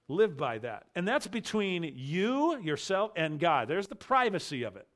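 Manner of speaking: ordinary talk, nothing marked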